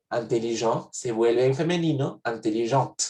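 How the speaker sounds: "intelligente" with the T clearly sounded at the end; French feminine "intelligente", final T spoken; pronounced final T. Shown as a demonstration. Teacher to students, showing the feminine with its T sounded. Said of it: "ahora sí lo voy a pronunciar".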